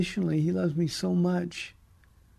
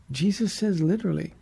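ordinary talk

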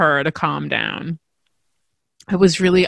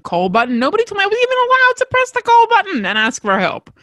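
rising incredulous questions, climbing higher; disbelief; 5 semitones higher than usual. Retold or imitated. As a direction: ever more stunned, going up to a high squeak. Stunned, indignant reply to nurse.

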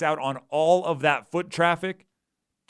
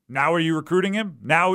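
ordinary delivery

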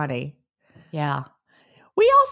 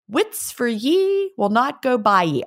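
higher, lilting Scots WITS and YE; Scots dialect saying; 5 semitones higher than usual. Shown as a demonstration. Trying the translated saying back in Scots dialect. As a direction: say it with Scots "ye" for you. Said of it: "which translates to".

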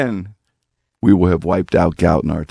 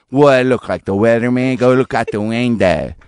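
put-on Pittsburgh assistant voice; sassy retort in a Pittsburgh accent. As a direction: answer back in broad Pittsburgh dialect. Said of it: "I have mine in a Pittsburgh".

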